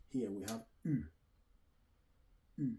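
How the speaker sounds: U said with rounded lips, repeated; French U sound. Shown as a demonstration. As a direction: model the French U carefully. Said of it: "That's phonetic".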